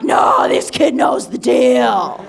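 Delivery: high, loud, excited guy voice; hyped-up bro. Retold or imitated. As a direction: yell it like a pumped buddy at a bar. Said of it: "He's like".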